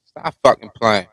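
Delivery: normal talking voice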